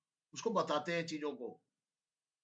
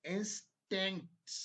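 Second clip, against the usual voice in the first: slow dictation; 2 semitones higher, slower than usual. Shown as a demonstration. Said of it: "फिर से बोल रहा हूं मैं".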